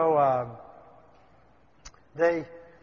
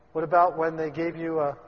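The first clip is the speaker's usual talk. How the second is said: normal talking voice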